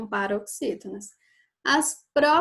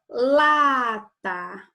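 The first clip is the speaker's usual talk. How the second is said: "lata" with LA loud and drawn out; stress on the first syllable; 6 dB louder than usual. Shown as a demonstration. Teacher showing pupils the stressed syllable, LA hit hard in "lata". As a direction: punch and stretch the "la" of "lata". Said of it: "O lá é o mais forte".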